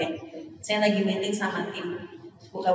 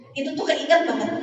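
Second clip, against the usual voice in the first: normal talking voice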